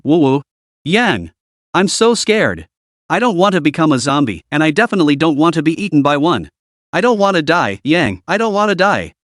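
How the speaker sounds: ordinary delivery, nothing marked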